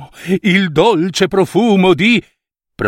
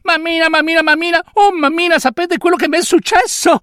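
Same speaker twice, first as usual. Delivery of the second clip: calling for mama; faster than usual. Acted. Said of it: "chiamò gridando la mamma".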